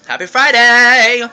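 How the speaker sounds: loud, high, drawn-out cheer; excited cheer. Real, not acted. Wishing the vlog viewers happy Friday, excited cheer. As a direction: call it out slowly and jubilantly.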